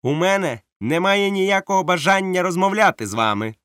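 high, loud cold refusal; captain refusing to parley; curt captain. Acted. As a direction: shut him down flatly. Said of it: "сказав капітан Смоллет".

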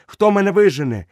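high, loud indignant challenge; boy loudly defies the dying wolf; defiant outburst. Acted. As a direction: fling the question back. Mowgli defiant question.

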